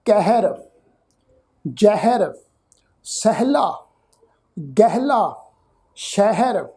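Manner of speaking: ਕਹਿਰ ਜ਼ਹਿਰ ਸਹਿਲਾ said with the ha as an open e sound; spaced, deliberate examples, ha folded into vowel; example words, careful. Shown as a demonstration. Teacher listing example words for students, careful pronunciation. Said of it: "ਜਿਵੇਂ ਅਸੀਂ ਸ਼ਬਦ ਪੜ੍ਹਦੇ ਹਾਂ ਜੀ".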